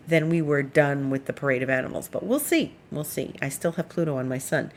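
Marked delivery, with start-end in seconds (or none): 2.1-2.7 high, lilting "we'll see"; playful doubt. Real, not acted. Unsure if animal parade ended. 2.9-3.3 quiet echo. Real, not acted. Unsure if animal parade ended.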